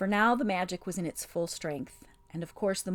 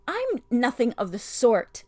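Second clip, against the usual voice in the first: slow, louder, indignant denial; indignant protest; 5 semitones higher, 8 dB louder than usual. A narrator's reading. Jill furiously denying the witch's mocking title. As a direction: dig in your heels, offended. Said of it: "stamping her foot".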